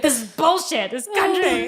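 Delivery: high, half-laughing mock outrage; freaked-out husband. Wife imitating husband fed up with the country, freaked out. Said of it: "Sean's, like, really freaked out".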